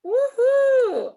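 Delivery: high, whooping woohoo; excited cheer. Real, not acted. Teacher cheering a student's fun introduction, whooping high.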